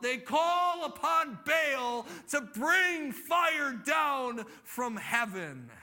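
loud, high, drawn-out calling; dramatic preaching. A narrator's reading. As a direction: build the story with long, ringing words. Preacher at full force.

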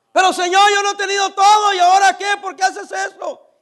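high, loud, pleading complaint to God; pleading believer. Retold or imitated. A believer complains to the Lord about his trials, high and pleading. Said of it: "Porque nunca has pasado por aquí".

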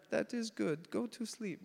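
high-pitched, poorly imitated Hebrew accent; bad Hebrew accent. Hebrew seatmate just woken up, asking in bad Hebrew accent. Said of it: "bad accent".

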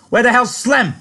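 high, loud threatening demand; loud, high, spoiling for a fight. A narrator's reading. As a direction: snap the question like he wants a fight. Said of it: "Curly looked threateningly round the room".

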